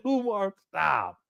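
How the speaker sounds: ordinary talk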